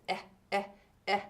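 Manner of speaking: short "eh" sound repeated; sounding out words. Shown as a demonstration. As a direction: say "eh" as a short vowel. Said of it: "it can say eh".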